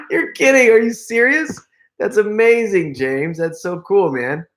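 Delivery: high, excited disbelief; delighted amazement. Real, not acted. Streamer reacting to an old friend's comment, delighted amazement.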